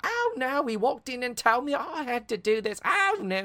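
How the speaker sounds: very high Cockney wail; squeaky, put-upon Cockney complaint. Retold or imitated. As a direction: whine it in hammed-up Cockney. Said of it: "Yeah, another Cockney".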